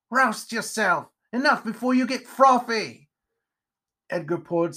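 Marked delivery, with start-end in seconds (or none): 0.1-3.1 crotchety, raised-pitch command; exasperated spider character. Acted. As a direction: order him to snap out of it. Spider orders him to stop.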